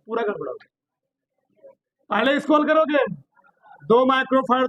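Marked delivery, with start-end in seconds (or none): 0.0-1.7 dismayed sigh. Real, not acted.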